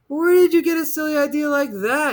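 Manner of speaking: put-on high boy's voice, amused; a boy teasing the monster; amused child. A narrator's reading.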